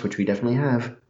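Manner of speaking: normal talking voice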